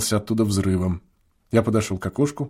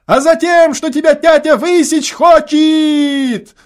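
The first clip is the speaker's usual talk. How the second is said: much higher, louder, slow drawn-out yell; a boy gleefully warning his brother of a whipping, hasty shout; 6 dB louder, slower than usual. A narrator's reading. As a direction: hurry to shout the threat across the field. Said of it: "поспешно прокричал первый голос".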